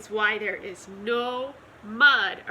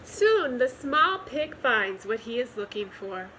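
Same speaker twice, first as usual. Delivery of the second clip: usual speaking voice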